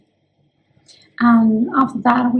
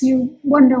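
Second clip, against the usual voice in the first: usual speaking voice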